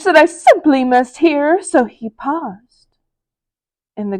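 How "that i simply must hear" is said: grinning Grinch. Acted. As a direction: savor it like a treat. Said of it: "grinned the Grinch".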